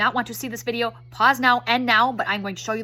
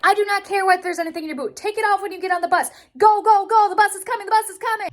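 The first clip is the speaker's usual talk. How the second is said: high, urgent yell building to a loud GO; frantic yelling; 8 semitones higher, 5 dB louder than usual. Acted. Mom to daughter as the bus is coming, frantic yelling. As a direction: holler like a panicked mom at bus time.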